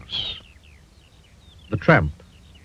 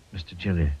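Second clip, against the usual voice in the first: plain reading voice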